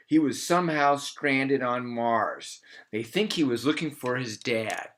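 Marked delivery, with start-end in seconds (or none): none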